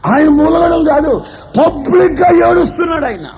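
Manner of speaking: fiery preaching. Real, not acted. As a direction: preach it out to the congregation with full force. Preacher rising to full force.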